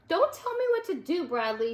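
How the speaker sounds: high, loud, angry snap. A narrator's reading. Sean snapping at Bradley for asking, loud high angry snap. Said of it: "Sean snapped".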